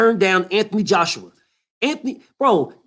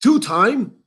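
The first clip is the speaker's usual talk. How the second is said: mocking fans.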